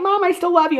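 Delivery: high, sweet-talking imitation of a daughter; mock daughterly pleading. Retelling a daughter visiting mom in prison, mock pleading. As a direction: voice the girl high and earnest.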